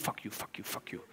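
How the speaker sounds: very soft, low mock swearing; muted swearing. Preacher admitting his prayer language, muted swearing. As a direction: swear under your breath, half-hidden. Swearing at God, deliberately toned down.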